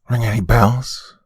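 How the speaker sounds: high, slow pointed question; prodding. A narrator's reading. Mother shows the book to her husband, prodding. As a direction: draw it out to needle him. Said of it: "Helen prodded".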